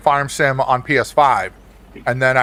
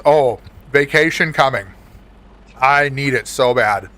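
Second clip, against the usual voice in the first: ordinary talk, nothing marked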